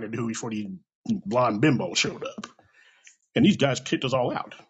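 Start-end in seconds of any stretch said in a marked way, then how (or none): none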